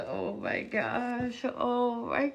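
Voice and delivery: usual speaking voice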